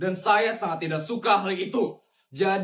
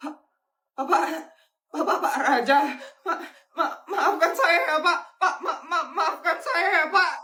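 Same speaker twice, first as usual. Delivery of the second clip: stammering plea; 7 semitones higher, 4 dB louder than usual. Acted. Boss begs owner, stammering.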